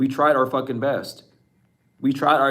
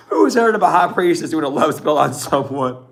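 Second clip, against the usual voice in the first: ordinary talk, nothing marked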